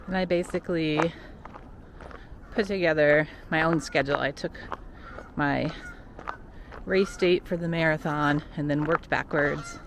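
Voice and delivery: ordinary talk, nothing marked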